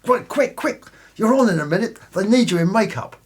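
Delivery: high hurried fuss. Acted. Stage door man hurries him.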